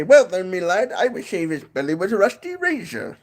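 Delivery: high, jaunty Irish brogue; Irish accent. Pretend. Vlogger answering the sailor riddle, jaunty Irish. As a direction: deliver the punchline in a hammy Irish lilt. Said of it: "do a little bit of an Irish accent".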